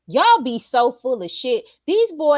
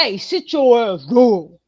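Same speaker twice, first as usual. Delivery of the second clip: lightly voiced stern dad, a touch lower; deep dad voice; 5 dB louder than usual. Mother imitating the dad ordering the boys, lightly deep. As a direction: bark it like the man of the house. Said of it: "with that deep ass voice".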